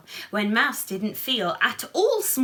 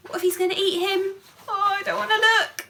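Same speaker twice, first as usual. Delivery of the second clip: fast, high, worried; nervous gasp; 9 semitones higher, 3 dB louder, much faster than usual. Real, not acted. Reader fearing the lion will eat the mouse, fast and high. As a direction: rush it out, afraid to look.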